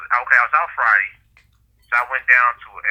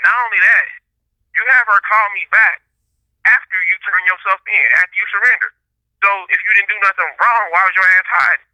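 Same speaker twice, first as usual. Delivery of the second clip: higher and louder, pressing him hard; retold angry confrontation; 6 semitones higher, 4 dB louder than usual. Telling off a coworker who hid from charges, heated and raised. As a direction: call him out like you're still mad about it. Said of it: "I was mad as hell".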